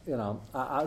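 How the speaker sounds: ordinary talk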